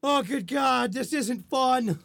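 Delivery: lightly acted dismay, slowing at the end; mock dismayed guest. Guest stuck at the farm, mock dismayed. Voices the frightened overnight guest.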